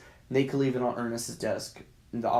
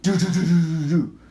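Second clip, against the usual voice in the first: loud rapid knocking noise; loud staccato pounding sound; imitated pounding; 6 dB louder than usual. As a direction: rattle off vocal door-banging.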